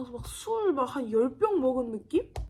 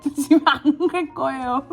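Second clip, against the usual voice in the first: slurred, slow, giggling demand; drunk-sounding drugged whine; 6 dB louder than usual. Retold or imitated. Drugged patient on the table, demanding cheese cutlet, slow and giggly.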